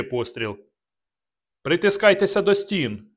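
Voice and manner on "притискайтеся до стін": high, loud warning shout; shouted order. Acted. Narrator warning his men to hug the walls, shouting. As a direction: yell it across the gunfire. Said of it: "крикнув я".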